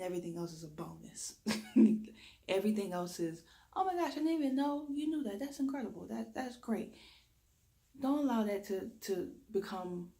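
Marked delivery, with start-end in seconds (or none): 3.7-6.9 high-pitched, quick, amazed gushing; impressed admirer. Acted. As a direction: gush as if pleasantly astonished.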